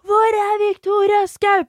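high, loud yelled name; retold high, loud howl of a name; mock yell. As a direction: holler the name across the party.

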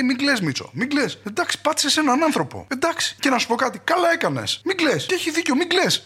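high mock-soothing voice of a cop comforting a buddy; consoling colleague. One officer comforting Mitsos after he ran someone over, mock soothing. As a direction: coo it like patting a crying friend. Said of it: "που λέει ο ένας τον άλλον, λέει".

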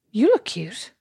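slightly surprised. Retold or imitated. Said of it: "slightly surprised".

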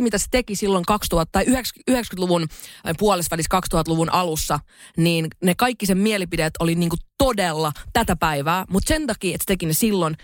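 ordinary talk

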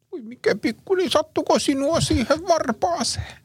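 very high, slow gushing coo; mock baby talk. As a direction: imitate someone fussing sweetly over a toddler. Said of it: "kun jengi sössöttää lapsille".

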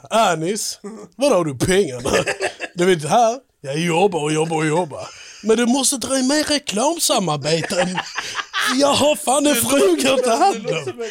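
hammed-up Scanian money plea; skånska. Badgering Anis about money, in mock skånska. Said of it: "prata om Robin Svensk på skånska".

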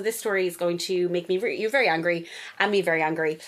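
ordinary talk, nothing marked

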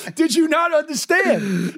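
high, slow shout of disbelief; shouted comic exasperation; exasperated retort. Retold or imitated. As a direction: spell it out in mock outrage. Acted exasperated reply to the complainer.

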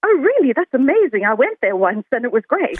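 imitated gush, high and loud at the start; gushing amazement. Quoting someone who has visited South Africa, gushing mock amazement. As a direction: act out an over-keen reaction, settling as it goes. Acts out second typical reaction.

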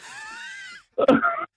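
squeaky overwhelmed laugh; high-pitched giddy laugh, soft; high breathless laugh. Real, not acted. Winner overwhelmed by win.

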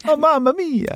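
high, hammed-up Italian film-plumber voice; mock Italian accent. Cohost teasing the film's casting, in a mock Italian accent. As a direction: put on a cartoonish Italian accent. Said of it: "will he do the Italian accent?".

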